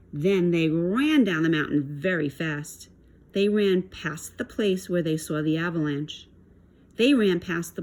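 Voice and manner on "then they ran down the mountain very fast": ordinary delivery, nothing marked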